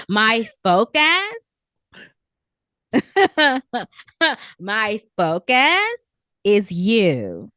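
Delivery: put-on slow, drawn-out Valley Girl quote; Valley Girl accent. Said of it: "this Valley Girl accent".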